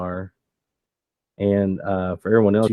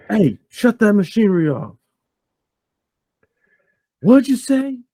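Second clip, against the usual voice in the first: high, raised holler; shouting from a balcony; 12 semitones higher, 4 dB louder than usual. Acted. Boss on the balcony to the workers below, shouting. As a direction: yell down over noisy machinery. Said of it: "from that balcony".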